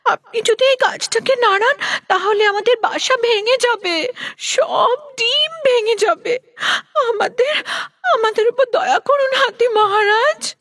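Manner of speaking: high-pitched pleading bird, tearful by the end; bird crying out. Acted. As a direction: beg in a high little bird voice, breaking into sobs. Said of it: "পাখি জোড়া জোরে চিৎকার করে উঠল". (উঁচু গলায় পাখির কান্নাভেজা মিনতি)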